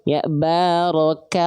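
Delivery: "barakallah" drawn out slowly with a thin, open A. Shown as a demonstration. Teacher correcting students, slow thin barakallah. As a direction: keep the A thin in "barakallah", not "barokallah". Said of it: "Jadi bukan barokallah".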